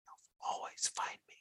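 eerie hushed whisper; creepy whisper. Acted. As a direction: breathe it like a stalker. Improv creepy threat.

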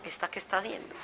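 low, hushed bewilderment; puzzled muttering. Retold or imitated. Father baffled by daughter cooking for him, puzzled muttering. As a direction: murmur it as a private baffled thought.

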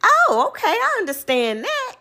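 high, sweet, fake-agreeable; put-on understanding tone; mock polite okay. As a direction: play along sweetly. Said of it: "I was like".

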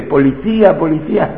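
normal talking voice

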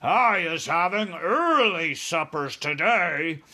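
loud, slow, relishing giant voice; giant's shout. A narrator's reading. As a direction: shout it slowly as a hungry giant. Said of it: "he shouted".

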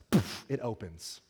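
slow, laughing reveal; amused laugh. Retold or imitated. Preacher telling the congregation the lap bar popped, laughing. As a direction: chuckle through the punchline.

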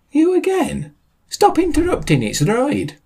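very high squeaky complaint. Acted. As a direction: squeak it out indignantly. Interjection in squeaky voice.

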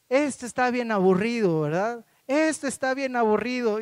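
a daughter nagging her father to leave; bored little girl. Retold or imitated. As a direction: nag it like a bored kid. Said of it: "todo el tiempo estaba diciendo".

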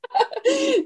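sudden high laugh; host's real amused laugh; high burst of laughter. Real, not acted. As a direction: laugh out, delighted by the answer. Host laughs at the answer.

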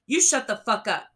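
screamed at full force, high and loud; furious yell. A narrator's reading. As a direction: bellow it in rage at the police. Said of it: "Jason yelled".

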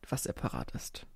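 whispered playful aside. Real, not acted. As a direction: drop to a secret whisper. Repeats "separat ist" as whispered aside.

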